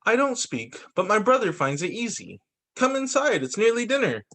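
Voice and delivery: picture-book read-aloud. A narrator's reading. Reader voicing siblings called in to dinner, picture-book read-aloud. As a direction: lift the pitch for the book's characters.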